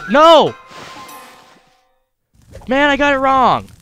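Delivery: high, loud real yell; frustrated shout. Real, not acted. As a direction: cry out at the mistake.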